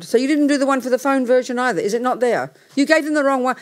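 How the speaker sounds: she's scolding over the wrong printout; exasperated scolding. Real, not acted. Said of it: "I'm getting angrier by the minute".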